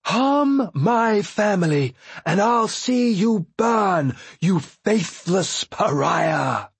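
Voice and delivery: high, slow, menacing threat; fierce threat. Acted. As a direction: threaten with burning fury. Vivacia threatens Paragon.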